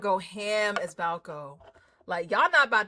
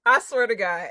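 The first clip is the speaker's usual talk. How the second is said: ordinary delivery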